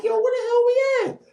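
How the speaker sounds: high, startled question; a high, baffled yelp; high bewildered. Retold or imitated. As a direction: squeak it out, totally lost. Confused at late hour.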